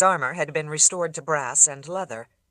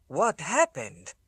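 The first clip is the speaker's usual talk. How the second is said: put-on strange accent; slower than usual. A narrator's reading. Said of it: "His voice had a strange accent".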